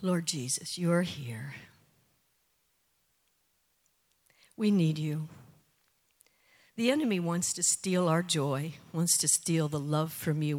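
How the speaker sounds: closing prayer. Acted. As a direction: pray over them gently and earnestly.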